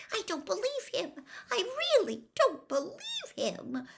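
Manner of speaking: high, shaky disbelief; unsteady voice. A narrator's reading. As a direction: let the voice wobble with shock. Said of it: "in a very unsteady voice".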